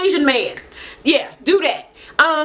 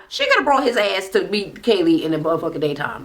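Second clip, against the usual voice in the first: ordinary talk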